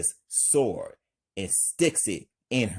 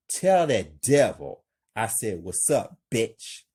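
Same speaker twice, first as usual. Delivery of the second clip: usual speaking voice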